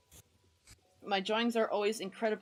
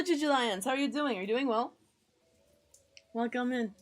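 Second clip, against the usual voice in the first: usual speaking voice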